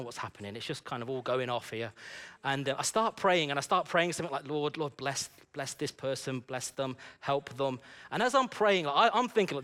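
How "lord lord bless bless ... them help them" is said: soft, low, halting mumbled prayer; weak prayer. Retold or imitated. Flustered man praying over a woman, weakly. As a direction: pray feebly, as if unsure it will work. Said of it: "This is a weak prayer".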